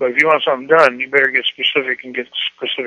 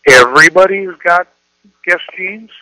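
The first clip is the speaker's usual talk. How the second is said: everybody's got guest jeans? with a loud, high disbelieving rise on guest; questioning intonation; 4 semitones higher, 6 dB louder than usual. Shown as a demonstration. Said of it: "one is intonation".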